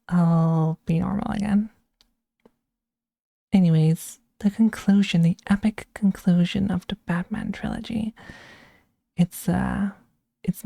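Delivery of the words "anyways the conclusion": usual speaking voice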